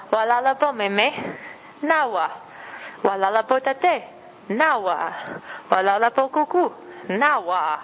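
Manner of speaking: Walala po meme said slowly, lifted on each greeting; greeting mother, father and grandparent, slow and careful; Oshindonga greeting. Shown as a demonstration. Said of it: "practice saying".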